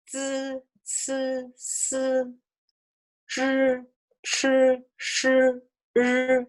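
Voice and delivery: "zi, ci, si, zhi, shi, shi, ri" on a high, level pitch; each syllable held evenly, set apart; demonstrating consonant sounds. Shown as a demonstration. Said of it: "The third line".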